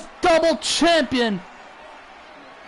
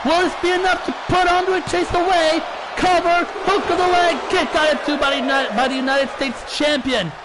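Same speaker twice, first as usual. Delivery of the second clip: wrestling commentator; 4 semitones higher than usual. Real, not acted. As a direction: shout the big moves as they land. Live wrestling play-by-play climax.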